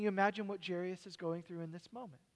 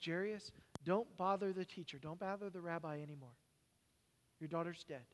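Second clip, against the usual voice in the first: grim news; 7 dB quieter than usual. Acted. Messenger brings death news.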